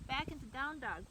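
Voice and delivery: normal talking voice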